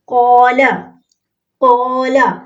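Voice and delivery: slow, clear recitation of कौला with long first vowel; recited Arabic word. Shown as a demonstration. Teacher recites the word for students, slowly. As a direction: recite कौला drawing out the alif. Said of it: "सबसे पहला लफ्ज़ है कौला".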